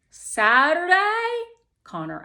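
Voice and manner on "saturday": high, loud disbelieving Saturday; disbelief. A narrator's reading. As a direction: blurt it out in shocked disbelief. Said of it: "disbelief in his voice".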